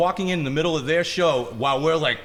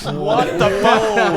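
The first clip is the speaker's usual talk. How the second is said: slow, drawn-out what the fuck, louder and higher; mock stunned reaction to the singer's threat; stunned disbelief; 6 semitones higher, 7 dB louder, much slower than usual. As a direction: stretch it out, dumbfounded.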